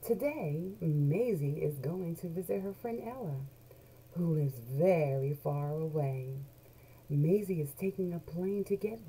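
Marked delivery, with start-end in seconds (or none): none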